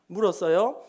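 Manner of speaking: ordinary delivery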